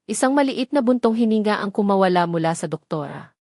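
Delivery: plain reading voice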